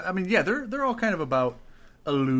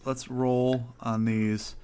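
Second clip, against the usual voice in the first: usual speaking voice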